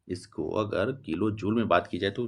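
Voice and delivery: ordinary delivery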